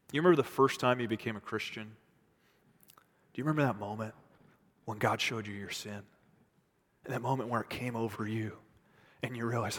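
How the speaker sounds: low, soft, intimate appeal; hushed preaching. Real, not acted. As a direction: bring the voice down low and gentle. Preacher drops into intimate address.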